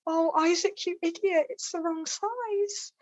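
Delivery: high, playful telling-off; mock exasperation. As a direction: scold him fondly, voice way up.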